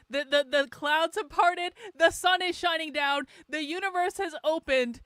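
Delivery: mock grandeur. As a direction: announce it like a prophet, tongue in cheek. Mock-grand proclamation of good fortune.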